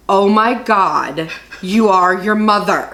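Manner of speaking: slow, drawn-out imitation of an astonished husband; mock exasperation. Wife retelling her husband's verdict on her, in mock exasperation. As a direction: stretch every word like a stunned verdict.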